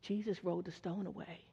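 breathless hushed whisper, no voice at all. Shown as a demonstration. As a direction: whisper it like passing on a secret. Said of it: "just whisper to them".

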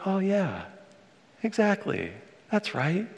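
high, delighted mock exclamation; excited agreement. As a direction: gush along as if replying to the TV. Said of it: "And I was like".

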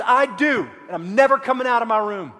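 put-on loud, fast defiant child; child refusing to leave the room, shouting back; stroppy kid. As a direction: yell it like a teen slamming the door. Sulking kid answers parent.

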